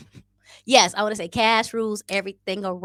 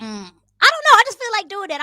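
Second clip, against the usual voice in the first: high squeaky voice; 13 semitones higher, 9 dB louder than usual. Pretend.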